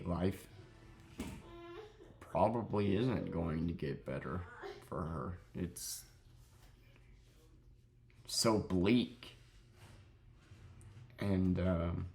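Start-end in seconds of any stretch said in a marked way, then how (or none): 0.0-9.4 trying not to cry. Real, not acted. Telling viewers about the old woman, fighting back real tears. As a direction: voice catching, close to real tears. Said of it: "I'm trying not to cry".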